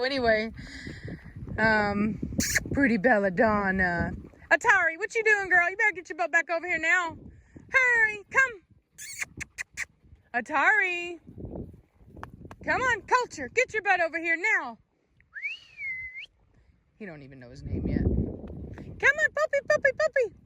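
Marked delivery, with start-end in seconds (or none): 4.5-14.8 higher firm call; calling the dogs. Real, not acted. Owner calling her dogs back across the yard. 18.0-20.3 much higher coaxing call; high puppy call. Real, not acted. Owner calling a puppy to come in a high voice.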